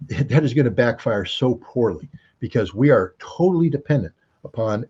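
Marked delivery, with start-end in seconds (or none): 0.0-0.1 slow, laughing under the breath; hushed, amused dread; laughing whisper. Real, not acted. Scoffing at sanctions on China.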